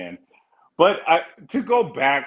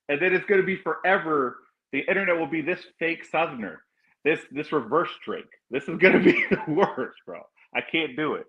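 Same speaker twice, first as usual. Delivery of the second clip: ordinary delivery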